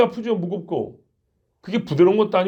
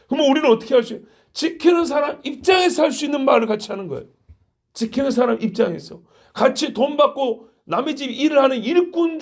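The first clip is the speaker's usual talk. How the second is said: raised, higher fervent sermon voice; preaching; 6 semitones higher than usual. Acted. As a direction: preach it with building intensity. Preaching at full force.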